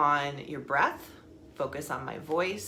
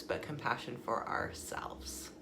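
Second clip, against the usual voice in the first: whisper; 5 semitones lower, 8 dB quieter than usual. Real, not acted. Whispered aside in meditation intro.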